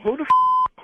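sudden loud, high cut-off curse; startled angry outburst. Real, not acted. Husband discovering a stranger on the call, loud startled curse. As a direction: blurt it out in alarm.

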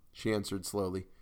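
ordinary delivery

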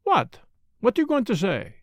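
sudden high What, pressing him; sharp surprise. Acted. Poirot spinning on the doctor, sharply demanding. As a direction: whirl round and demand it. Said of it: "He spun round on me".

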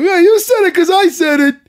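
high, loud mocking voice through a laugh; mocking Longo. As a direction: ape Longo's smug retort, laughing. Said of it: "Longo takes that from me too".

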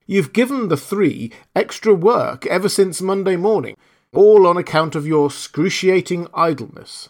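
high, loud scolding spirit; a genie rebuking a lazy camel; stern genie. Acted.

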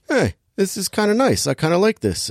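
lightly mocking impression of a tough guy relaxing; mock Gerard Butler. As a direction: pitch it up and play him pleasantly surprised. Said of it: "Gerard Butler's like".

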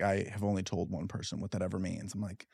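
mock-secretive near murmur; hushed retelling. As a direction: drop low and very soft, confiding. Retells cousin's secret and own reaction.

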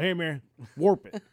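taunting a buddy at the tee. Retold or imitated. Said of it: "we used to always do that to him".

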